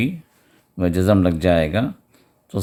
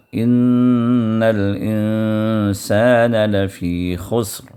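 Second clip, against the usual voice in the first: drawn-out, measured tajweed chant; slow Quran recitation; much slower than usual. Shown as a demonstration. Teacher reciting Surah Al-Asr for students, slow chant. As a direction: recite each word slowly and melodically.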